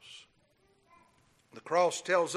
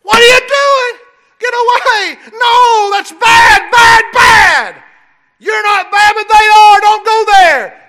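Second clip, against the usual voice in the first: high, loud shout, slower on "Don't go there"; snatch the child back from the snakes; mother yelling at her baby at the rattlesnake bin; alarmed scolding yell; 22 dB louder, slower than usual. Pretend. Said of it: "You'll snatch him up".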